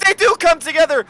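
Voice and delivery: high, loud, punchy shout; shouted race call. Real, not acted. Commentator confirming the cars hit, excited shout. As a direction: confirm the crash at full excitement.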